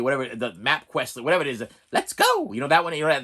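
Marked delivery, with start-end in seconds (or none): 1.9-2.5 mock chirpy sat-nav voice, much higher; sing-song robot cheer; navigation lady. YouTuber imitating the map app voice. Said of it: "the Google lady".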